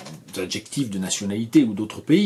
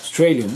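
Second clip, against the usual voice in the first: Australian with only STRAY really heard, raised; stress on STRAY; 3 semitones higher, 4 dB louder than usual. Shown as a demonstration. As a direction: punch the second syllable of Australian, swallow the rest. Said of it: "c'est la deuxième".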